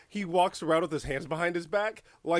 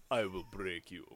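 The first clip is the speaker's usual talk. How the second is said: menacing villain voice; 8 semitones lower, 10 dB quieter than usual. Retold or imitated. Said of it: "Bane's superpower".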